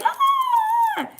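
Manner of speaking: very high squeal of joy; imitated high-pitched happy squeal; excited squeal. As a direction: squeal like thrilled spirits. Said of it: "they're like".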